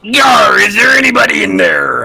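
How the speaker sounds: loud, high pirate hail. Pretend. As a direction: bellow like a pirate captain. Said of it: "do your best pirate impersonation".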